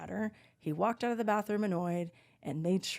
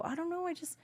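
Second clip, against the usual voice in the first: higher, softer innocent voice; mock innocent; 5 semitones higher, 4 dB quieter than usual. Telling friends what happened, innocent and soft. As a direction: play it sweet and blameless. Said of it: "I go".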